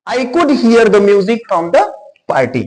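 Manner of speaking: slow, careful English example; deliberate teacher read; slow example sentence. Shown as a demonstration. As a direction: spell each word out slowly for learners. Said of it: "लेकिन मुझे सुनाई दिया".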